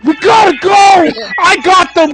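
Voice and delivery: urgent high shouting; frantic yelling. Acted. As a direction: yell it like a panicked teammate. Acted frantic tag-team call.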